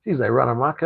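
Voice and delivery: ordinary talk, nothing marked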